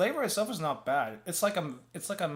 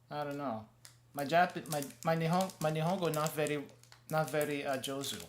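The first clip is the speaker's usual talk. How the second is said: ordinary delivery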